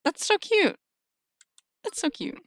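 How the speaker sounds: small, high cooing; tiny gushing squeal. Real, not acted. Charmed by a spider wedding planner, high little coo. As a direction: coo it under your breath, charmed.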